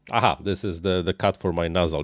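ordinary delivery

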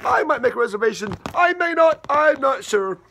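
waver, can't make up your mind; indecisive captain. Acted. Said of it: "Captain Schmidt?".

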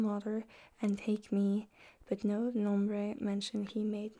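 whispered Middle English reading; library whisper. Acted. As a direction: read the old verse in a hushed whisper. Reads Chaucer aloud in whisper.

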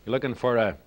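ordinary delivery

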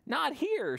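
high, small bug voice; little caterpillar. Acted. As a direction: make it tiny and high. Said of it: "says Hairy Caterpillar".

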